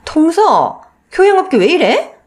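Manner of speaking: sister-in-law snapping in disdain; haughty sister-in-law. Acted. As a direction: look down on her and scold. Sister-in-law rebukes wife.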